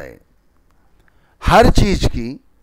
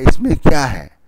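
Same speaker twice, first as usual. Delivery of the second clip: ordinary talk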